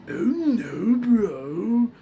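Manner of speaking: beginner's high, loud attempt; Muscle Man. Shown as a demonstration. Warning viewers how they will first sound, rough Muscle Man attempt. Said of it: "you're most likely gonna sound like".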